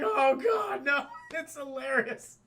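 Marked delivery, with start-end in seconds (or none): 0.0-1.0 mock panic. Old man chased, laughing.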